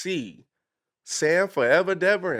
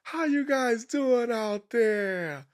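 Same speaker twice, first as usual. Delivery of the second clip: emcee greeting; 8 semitones higher, much slower than usual. Retold or imitated. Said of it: "and you're like this".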